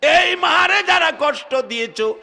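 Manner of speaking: high, loud preacher's admonition; thundering rebuke. Real, not acted. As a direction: call out the wrongdoers at full force. Preacher rebukes those who hurt mothers.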